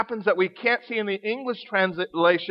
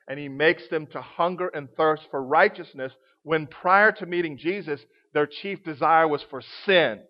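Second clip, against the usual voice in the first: preaching; 5 semitones lower than usual. Acted. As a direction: bring it down to a hushed conviction.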